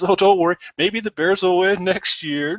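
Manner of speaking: hammed-up gloating dad voice; mock gloating dad. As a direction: rub it in playfully like a teasing father. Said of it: "he goes".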